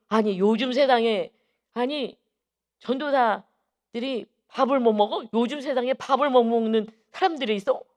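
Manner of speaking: imitated astonishment, high and loud; shocked disbelief. Chairman learns evangelists go hungry these days, retold shock.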